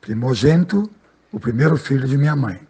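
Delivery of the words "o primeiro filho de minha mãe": ordinary talk, nothing marked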